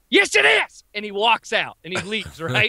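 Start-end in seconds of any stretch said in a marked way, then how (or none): 0.1-0.8 high mock-preacher retort; defiant kid. Kid answering the cashier, high defiant holler. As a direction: shout it back, high and strained. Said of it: "looks at the chick and goes".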